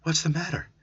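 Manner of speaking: nervous and jumpy; a jolt of fear, voice up; frightened question. Acted. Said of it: "She looked frightened".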